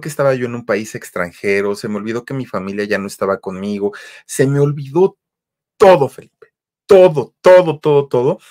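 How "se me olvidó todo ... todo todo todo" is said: higher and louder on each todo, building then easing off; rising exclaimed todo; swelling emphasis. Retold or imitated. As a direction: let todo burst out with wonder. Overwhelming peace, everything forgotten.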